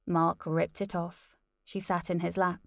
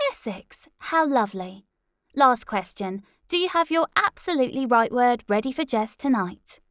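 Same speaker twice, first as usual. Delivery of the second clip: chirpy host; 5 semitones higher, 6 dB louder than usual. Acted.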